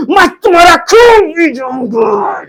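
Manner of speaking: hammed-up angry shout; angry threat. Shown as a demonstration. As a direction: yell it like a furious villain. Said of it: "डांटते हैं क्रोध".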